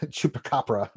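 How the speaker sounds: usual speaking voice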